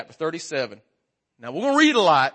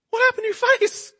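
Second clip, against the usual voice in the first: high, shrill-pitched gasp of a startled wife; shocked wife. Retold or imitated. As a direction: yelp it like she just saw a stranger. Wife shocked at shaved beard.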